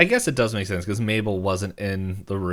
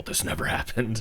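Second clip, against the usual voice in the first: a quick secretive whisper; hushed whisper; 6 dB quieter than usual. Retold or imitated. Dipper whispering to Mabel that this never happened.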